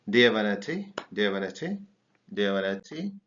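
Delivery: want a said as wanna, rising; contracted. Shown as a demonstration. Teacher drilling the wanna contraction. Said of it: "wanna, wanna".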